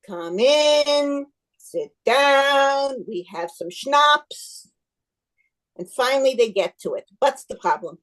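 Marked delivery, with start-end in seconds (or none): 0.1-4.7 slow hospitable invitation; drawn-out welcome. Acted. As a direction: stretch each invitation out, settling at the end. Rabbi drawls out hospitable welcome.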